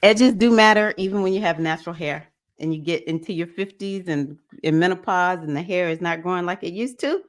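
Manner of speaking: ordinary talk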